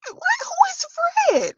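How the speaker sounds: high, slow, baffled squeal of disbelief; mock confused. Vlogger retelling her reaction to the troll comment, mock baffled squeal. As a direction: stretch it out in shocked confusion.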